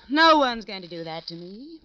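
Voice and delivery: loud defiant yell; loud outraged cry of a wronged wife; furious shout. Acted. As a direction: hurl it back at him. Furious outburst over husband's contempt.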